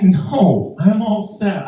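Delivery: high, emphatic acted refusal; mock-alarmed no thanks. Acted refusal of nurse's offer.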